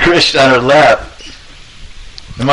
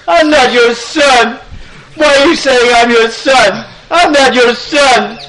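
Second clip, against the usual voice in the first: high-pitched hammed-up tearful protest; angry, crying child; 11 semitones higher, slower than usual. Krishna pushing his mother away, crying angrily. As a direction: wail it like a furious little boy. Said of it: "He was very angry and unhappy".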